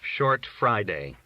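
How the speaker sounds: ordinary delivery, nothing marked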